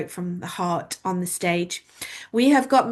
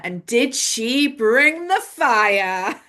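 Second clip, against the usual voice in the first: laughing; 5 semitones higher, much slower than usual. Real, not acted. Host laughing while hyping speaker.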